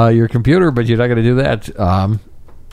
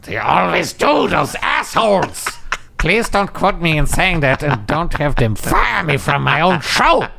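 cartoon villain voice; 7 semitones higher than usual. Voicing Boris character complaining.

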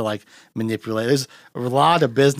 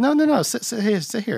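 higher, coaxing insistence; quoting Gene Wilder; 11 semitones higher than usual. Retold or imitated. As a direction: urge him to sit nicely.

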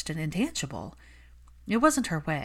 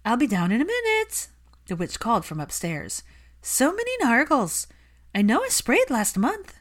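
much higher, louder dreamy call; Luna calling down; 7 semitones higher, 4 dB louder than usual. Acted. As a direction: call from upstairs, unbothered by Nargles. Luna calls from upstairs.

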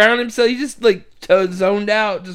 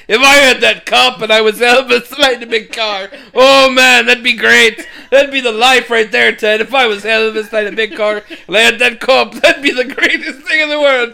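higher, loud, gushing excitement; excited Dougal; 5 semitones higher, 6 dB louder than usual. Retold or imitated. Dougal dreaming of a big car, gushing with excitement. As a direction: gush over it like a thrilled simple priest.

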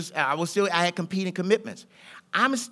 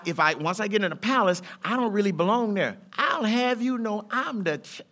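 usual speaking voice

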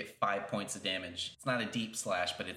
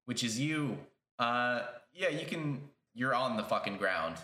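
usual speaking voice

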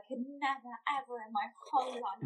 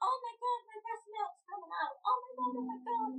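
retold excited amazement; 10 semitones higher than usual. Mother reliving her breast milk coming out, excited. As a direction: relive the astonishment in a high-pitched rush of feeling.

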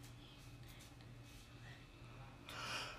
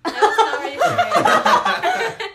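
loud high laughing, slow; laughing hard, higher and louder; 10 semitones higher, 20 dB louder, much slower than usual. Real, not acted. Laughing after wax strip pull.

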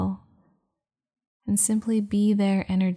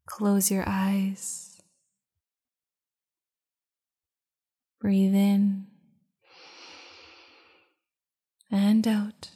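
guided meditation. Acted. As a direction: guide them into calm, unhurried. Leads a meditation.

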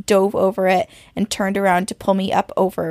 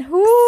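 loud, high-pitched "whew" of relief from chills; 13 semitones higher, 6 dB louder than usual. Real, not acted. Reader shaking off full body chills, high whew. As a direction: let out a rising whoop of breath.

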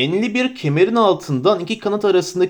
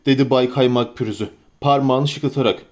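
plain reading voice

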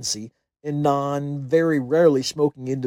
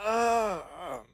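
soft, strained high "ah"; slow wheeze; 4 semitones higher, 6 dB quieter than usual. A narrator's reading. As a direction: wheeze it out slowly through smoke-filled lungs. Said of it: "wheezed slowly out".

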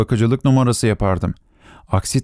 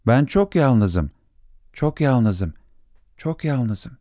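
slow echo, much softer each repeat; fading echo; 2 semitones higher, 3 dB quieter, much slower than usual. Acted. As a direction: let the loneliness echo away into silence. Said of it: "yankı".

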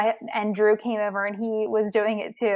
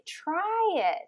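a mildly voiced, coaxing Sam, going up high and the last phrase slowed; lightly acted pleading Sam, reading to a child; Sam from the book; 8 semitones higher, much slower than usual. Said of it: "Sam is like".

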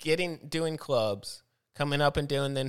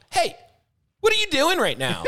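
higher, louder mock yell at a friend; retold yell; 14 semitones higher, 5 dB louder than usual. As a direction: replay the moment you snapped at him. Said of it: "I yelled".

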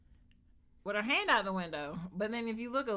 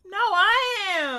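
high, loud, slow insistence; emphatic slow correction; 11 semitones higher, 11 dB louder, much slower than usual. Real, not acted. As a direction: spell it out loudly. Correcting husband loudly.

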